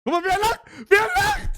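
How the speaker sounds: high, loud teasing glee; gleeful shout. Real, not acted. Gleeful teasing at laughing rival.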